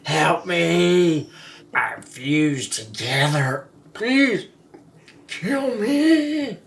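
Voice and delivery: high, lightly voiced desperate pancake; pleading pancake voice. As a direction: beg for rescue in a small voice. Voicing the fused pancakes.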